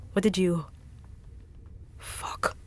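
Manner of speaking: usual speaking voice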